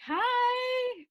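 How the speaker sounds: chirpy excited hello; eager high greeting. Retold or imitated. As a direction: call out like you're starved for company. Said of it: "I'm like".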